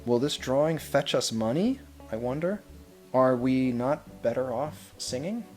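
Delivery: usual speaking voice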